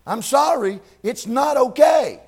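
full-throated preacher shout. Real, not acted. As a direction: let it burst out loud. Sermon building to emotional peak.

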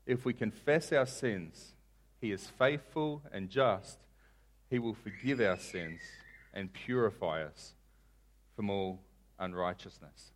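soft, slow, phrase by phrase; measured, with pauses, sinking at the end; slow scripture reading. Shown as a demonstration. Preacher quoting John to the congregation, slowly. Verse read slowly, then repeated quicker.

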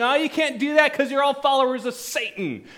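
high, loud sneering accusation; mock them as Satan's followers; scornful accusation. Religious leaders accusing disciples.

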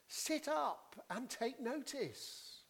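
slow, high, emphatic command; deliberate, insistent call; preacher's exhortation. Real, not acted. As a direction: urge them to pay attention, word by word. Preacher urges congregation to attend.

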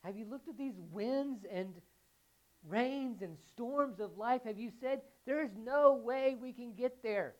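slow, high and loud challenge; preaching at full force. Acted. Pastor to congregation, slow fervent challenge.

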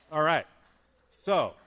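loud call. Real, not acted. Calling out to the congregation.

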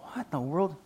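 puzzled mutter. Retold or imitated. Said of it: "He's like".

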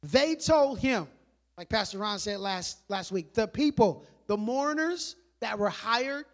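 ordinary talk, nothing marked

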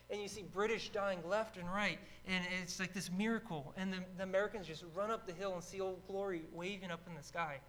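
ordinary delivery

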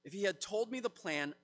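ordinary delivery

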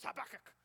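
Habakkuk burst out in a sudden, forceful punch; exaggerated emphasis. Shown as a demonstration. Preacher jokes with listeners about saying it, Habakkuk explosively emphasised. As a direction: spit out Habakkuk with over-the-top emphasis. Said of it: "say it with a real emphasis".

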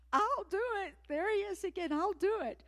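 high-pitched, loud cry of yes; eager volunteer. Retold or imitated. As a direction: leap at it. Volunteering again for the harp.

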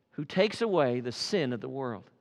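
low and soft, set against the cry; quiet reprise. Real, not acted. Quiet reprise of John's words.

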